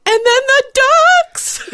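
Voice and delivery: high, slow wail breaking into laughter; hammed-up sob through a laugh; crying mom. Daughter imitates mom sobbing about the ducks, laughing. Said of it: "she calms down and she's like".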